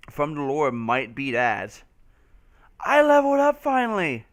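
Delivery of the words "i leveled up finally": high excited cheer. Real, not acted. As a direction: celebrate the level up. Game level up.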